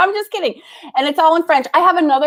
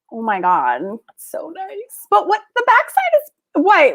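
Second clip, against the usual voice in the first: ordinary talk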